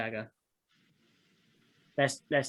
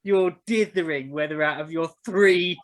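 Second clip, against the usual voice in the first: loud, higher-pitched mock outrage; exasperated urging; 6 semitones higher, 9 dB louder than usual. Real, not acted. Game master scolds player dithering over three daggers, exasperated. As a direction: chide them at full volume.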